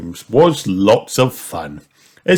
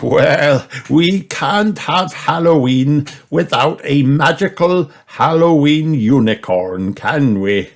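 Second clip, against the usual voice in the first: amused grandpa; 7 semitones higher, 4 dB louder than usual. A narrator's reading. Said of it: "laughed Grandpa Pig".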